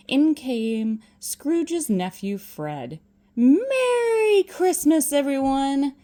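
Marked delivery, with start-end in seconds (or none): 3.3-5.9 high, loud, drawn-out greeting; loud festive shout; cheery call. Acted. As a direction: call out a merry Christmas to the room. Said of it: "Fred called".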